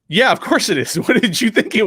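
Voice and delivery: a quote delivered high through a laugh; amused, as if it were obvious all along; laughing wife. Retold or imitated. Wife on the phone, amused, answering through a laugh. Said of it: "And she goes".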